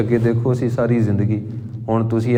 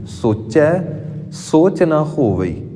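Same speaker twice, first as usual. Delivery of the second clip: a short halt after ਸੋਚਿਆ, then ਸੋਚ weighted; teacher shows where to pause in the verse; 4 semitones higher, slower than usual. Shown as a demonstration. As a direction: recite slowly, resting after ਸੋਚਿਆ. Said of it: "ਥੋੜ੍ਹੇ ਸਮੇਂ ਲਈ ਰੁਕ ਜਾਣਾ".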